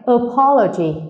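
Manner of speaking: apology with PO, the second syllable, stressed. Shown as a demonstration. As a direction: lean hard on the po in apology. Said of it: "chúng ta nhấn vào po".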